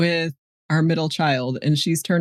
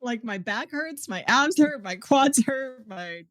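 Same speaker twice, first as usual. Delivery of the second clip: mock whining; 6 semitones higher, 4 dB quieter, slower than usual. Wife mock-whines her own complaints.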